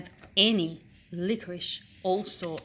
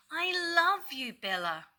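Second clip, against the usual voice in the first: a hint of a fond high dog voice; lightly voiced dog; 10 semitones higher than usual. Daisy the dog to Bella, a touch of fond high voice. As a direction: gush a little. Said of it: "licked Daisy".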